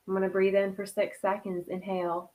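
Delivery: soft, hushed meditation voice; a quiet spiritual breathing guide; guided meditation. Acted. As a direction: guide them into calm, breath by breath.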